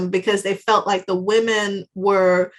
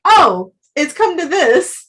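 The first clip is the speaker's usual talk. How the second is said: resigned shrug; 6 semitones higher, 3 dB louder than usual. Retold or imitated. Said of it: "They're like".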